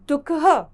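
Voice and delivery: दुखः with a breathy ह at the end; clear demo. Shown as a demonstration. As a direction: model दुखः for the class. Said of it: "इसे हम बोलेंगे दुखः". (दुखः, अंत में हल्की ह)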